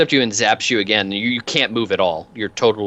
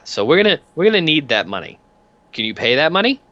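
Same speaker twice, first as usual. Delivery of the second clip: higher, lightly voiced enforcer making a demand; debt collector leaning in; 6 semitones higher, faster than usual. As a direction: press a debtor, politely menacing. Peanuts leans on debtor.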